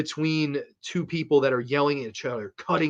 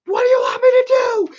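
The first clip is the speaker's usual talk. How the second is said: high wailing scream, much higher and louder; screaming through tears; 10 dB louder than usual. Retold or imitated. Wife screaming at husband mid-fight, crying shout. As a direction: shriek it out like she's breaking down while crying. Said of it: "She's like".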